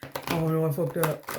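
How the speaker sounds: low, mumbled 'oh no, I messed up'; a touch of defeated self-pity; imitating the querent's gloomy self-talk; mock dejected. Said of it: "that's what I'm hearing right now".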